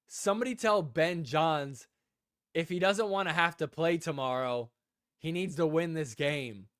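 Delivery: ordinary talk, nothing marked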